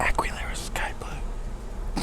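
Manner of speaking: soft unvoiced whisper. Shown as a demonstration. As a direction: whisper it into the mic. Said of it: "They whispered into the mic".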